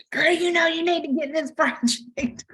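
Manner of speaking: be the pushy friend hyping her up, up high; sassy friend. Retold or imitated. Friend urging her to start the project, in a high sassy voice. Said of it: "Tasha said it best when she said".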